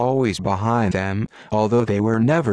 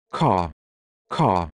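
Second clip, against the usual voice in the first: a lightly voiced bird's caw, a touch low; jackdaw caw; 3 semitones lower than usual. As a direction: caw it out like a jackdaw, only mildly. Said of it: "cried the jackdaw".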